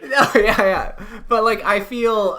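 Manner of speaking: high, loud, amused backtracking; giggly and high, trying to get back on track; laughing agreement. Real, not acted. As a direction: concede through real laughter. Still laughing while trying to answer.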